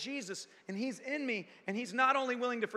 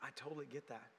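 much softer, lower, tender; gentle empathy; 8 semitones lower, 16 dB quieter, faster than usual. Acted. Jesus empathizing with the hurt preacher, very soft and tender. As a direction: say it like an understanding friend. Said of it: "He comes right in and goes".

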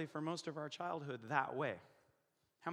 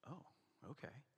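very soft, low "oh, okay"; tiny, cowed murmur of agreement; meek hushed reply; 3 semitones lower, 18 dB quieter than usual. Retold or imitated. Kids answering their aunt, meek and hushed. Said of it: "And we're like".

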